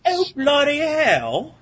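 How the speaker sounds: slow, high hammed-up British accent; drawn-out mock British exclamation. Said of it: "with my British accent".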